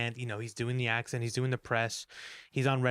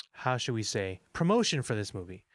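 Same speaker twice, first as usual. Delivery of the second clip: ordinary talk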